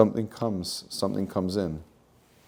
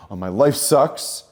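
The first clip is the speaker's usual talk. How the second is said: higher, louder, whining complaint; a victim airing every grievance, louder and higher; mock self-pitying victim; 6 semitones higher, 6 dB louder than usual. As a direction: ham up a sorry-for-myself moan. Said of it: "I'm a victim to the circumstance".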